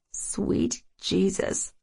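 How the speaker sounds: slow breathless whisper. Acted. Flustered inner exclamation.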